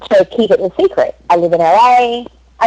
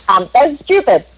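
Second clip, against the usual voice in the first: ordinary talk, nothing marked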